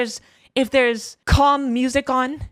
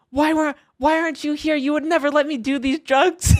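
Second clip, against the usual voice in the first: higher, pleading and upset; whiny plea; 6 semitones higher than usual. Retold or imitated. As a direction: wail it at the absent friend. Pleading to friends on phone.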